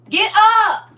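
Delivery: high, loud "get up"; mother shouting her daughter awake; loud wake-up call. Retold or imitated. Waking sleeping pregnant daughter.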